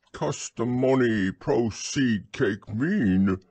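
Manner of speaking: "customony proceed cake" said slowly, word by word; puzzled mispronunciation. A narrator's reading. Pooh garbles Owl's long words.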